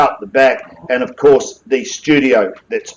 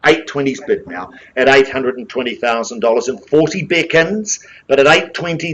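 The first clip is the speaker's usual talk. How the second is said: brisk auction patter, rising on calls; auctioneer calling bids; 2 semitones higher than usual. Real, not acted. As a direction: drive the room like a live auctioneer.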